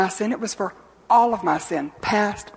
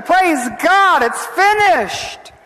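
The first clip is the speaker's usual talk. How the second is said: high, loud, jubilant shout; roared acclamation; 10 semitones higher, 7 dB louder, much slower than usual. Retold or imitated. Angels in heaven acclaim the victory, roaring. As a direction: roar it out in triumph, stretching the end. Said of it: "roared in acclamation".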